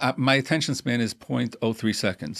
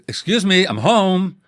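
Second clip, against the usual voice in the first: higher, louder indignant protest; husband protesting the cold welcome, raised voice; offended husband; 8 semitones higher, 9 dB louder, slower than usual. Acted. Husband offended, acted scene.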